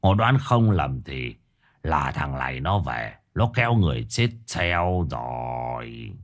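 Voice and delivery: put-on low, slow, softened old master's voice; grave old spirit master. A narrator's reading. Old master reveals what he saw to the family, grave and slow. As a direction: pronounce the disaster gravely, low and drawn out.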